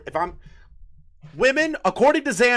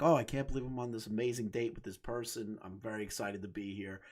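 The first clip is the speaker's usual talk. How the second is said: low, quiet, quickened murmur; acted private excitement kept under the voice; soft low mock delight; imagined date's thoughts; 9 semitones lower, 11 dB quieter, faster than usual. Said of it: "and you're like".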